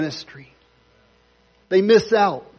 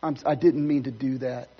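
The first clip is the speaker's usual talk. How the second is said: soft apology; 5 semitones lower, 7 dB quieter than usual. Pretend. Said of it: "will be ready to say".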